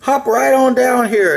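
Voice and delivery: high-pitched, coaxing invitation; enticing call pitched well up; coaxing stranger. A narrator's reading. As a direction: lure them in with a high voice. Hidden bear luring rabbit into stump.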